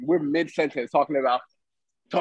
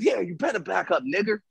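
higher-pitched, lightly mimicked taunt; taunting bus driver; 5 semitones higher, 4 dB quieter than usual. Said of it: "the dude says".

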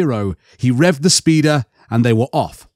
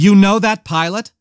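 sharp, higher-pitched demand; 6 semitones higher, 3 dB louder than usual. A narrator's reading. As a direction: demand an answer, pitch lifted. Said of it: "the Emperor's hand demanded".

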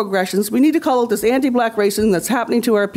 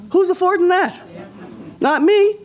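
indignant; 8 semitones higher than usual. Real, not acted. Rhetorical outrage at housing prices.

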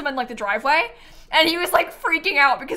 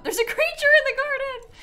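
high British-accented alarm, imitating Dan; a British husband spotting a creature; lightly imitated panicky Brit; mock British panic; 3 dB louder than usual. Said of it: "saying in his British accent".

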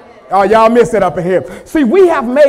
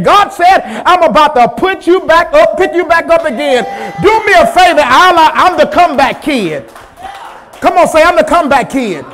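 loud, high call and response; preaching at full force; 4 semitones higher, 5 dB louder than usual. Acted. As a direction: drive the crowd to answer.

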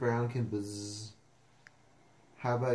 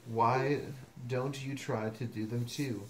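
usual speaking voice

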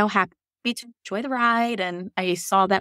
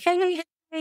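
bright high sing-song hellos; cheery greeting; 10 semitones higher than usual. Retold or imitated. Nurse greeting day shift coworkers, high cheery hellos.

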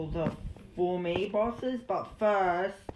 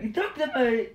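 accusing shout; 4 semitones higher, 4 dB louder than usual. Pretend. Accusing the intruder.

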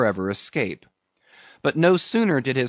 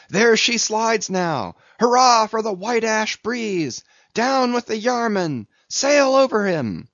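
exhilarating cry; 9 semitones higher, 3 dB louder than usual. A narrator's reading. Mates urge on their oarsmen in the whale chase, exhilarating cry. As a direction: call out high and rousing to spur the crew. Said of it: "with an exhilarating cry".

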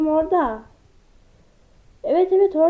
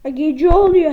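normal talking voice

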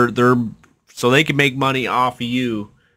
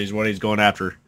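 ordinary delivery

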